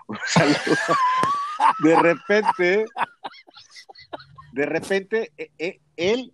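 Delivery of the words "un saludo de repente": laughing. Real, not acted. Laughing greeting to friend.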